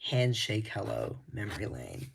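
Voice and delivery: normal talking voice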